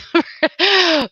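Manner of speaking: short high laugh that falls away; a quick amused laugh trailing down. Real, not acted. Answering Richard's comment, short high laugh.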